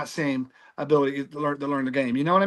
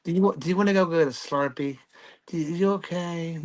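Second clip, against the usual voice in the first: mock coddling. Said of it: "You can't say".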